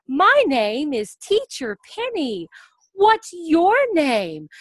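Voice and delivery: slow, clear, rising "What's your name"; slow teacher model. Shown as a demonstration. Teacher introducing herself to a child, slow and clear. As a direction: speak slowly to a young learner. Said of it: "when I say".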